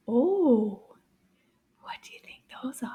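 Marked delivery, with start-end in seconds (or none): 1.8-2.9 quick, very soft whisper. Pretend. Teacher asks children about a mystery picture, whispering. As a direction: whisper it like a secret clue.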